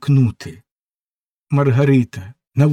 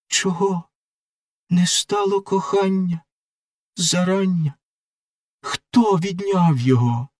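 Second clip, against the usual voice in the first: lightly voiced girl, wounded and reproachful; hurt young lover; 8 semitones higher than usual. Margarita reproaches Faust for his cold kiss, hurt. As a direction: demand his kiss, then sink into hurt.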